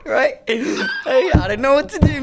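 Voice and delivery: high laughing retelling. Real, not acted. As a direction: crack up mid-story. Laughing while retelling panic.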